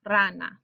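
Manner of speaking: "rana" stressed on its first syllable, falling away. Shown as a demonstration. Teacher repeating the word for frog, first syllable of "rana" stressed. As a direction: lean on the "ra" of "rana". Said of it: "that first syllable or second from last".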